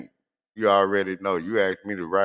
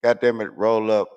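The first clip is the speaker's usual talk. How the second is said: normal talking voice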